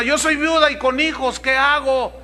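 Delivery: hammed-up anxious mother; desperate widow. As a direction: beg the pastor for an answer. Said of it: "dirá alguna".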